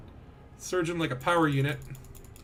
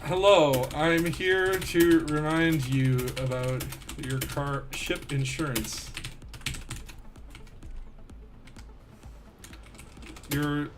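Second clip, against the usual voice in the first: slow, droning robocaller; mock insurance call; 4 semitones lower, 4 dB quieter, slower than usual. Gamer taunting his target about ship insurance, mock robocall. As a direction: drag it out like an automated reminder.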